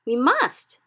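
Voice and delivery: loud high insistence; cried. A narrator's reading. Sophie loudly insisting to the BFG. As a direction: cry it out, pleading. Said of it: "Sophie cried".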